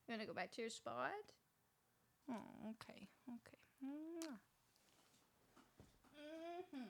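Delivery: soft whisper to a sleepy dog, ending in a kiss. Real, not acted. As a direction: murmur to the dog as you settle her in her spot. Putting the dog back to bed.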